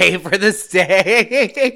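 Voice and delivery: high, slow laughing echo; laughing repeat. Real, not acted. Friend echoes the phrase through a laugh. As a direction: laugh the words out in disbelief.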